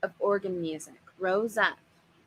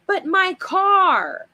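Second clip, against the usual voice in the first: much higher, much louder slow wail; shriek; 11 semitones higher, 9 dB louder, much slower than usual. Acted. Furious father wailing about his car, loud high shriek. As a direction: let it burst out as a shriek.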